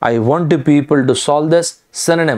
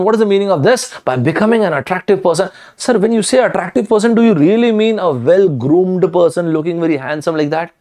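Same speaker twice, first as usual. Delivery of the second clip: higher-pitched voice of a doubting listener; mock bewilderment, pitched up; puzzled questioning; 4 semitones higher than usual. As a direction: question it like a baffled student. Acts out listener questioning him.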